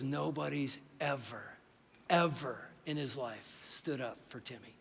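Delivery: understated, retold heartache; tearful mom. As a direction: let each phrase come softly, as if through tears. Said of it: "And she starts to cry".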